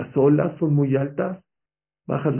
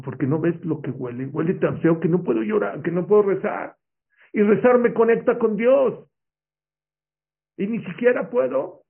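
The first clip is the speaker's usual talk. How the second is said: rising, louder, pleading and near tears; tearful lament; 7 semitones higher, 5 dB louder than usual. Acted. Rabbi lamenting to his friend in jail, voice rising toward tears. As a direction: cry out that he can't even pray. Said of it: "empezó a llorar".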